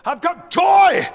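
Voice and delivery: loud, high preaching shout; preacher proclaiming. Acted. Choir director as preacher to a congregation, exultant shout. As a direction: shout it out from the pulpit, stretching "joy". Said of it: "if a preacher were preaching and said".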